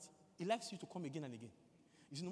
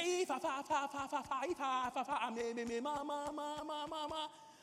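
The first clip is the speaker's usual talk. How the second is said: high, rapid-fire stutter on one word; stammering Moses; 8 semitones higher, 5 dB louder than usual. Acted. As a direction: stay stuck on the first syllable, raised and easing off. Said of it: "Because he was stammering".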